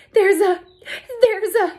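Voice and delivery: high, loud panicked stammer; scared shriek. Acted. Girl shrieking to her teacher about a worm, panicked. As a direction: squeal it out, terrified of a worm.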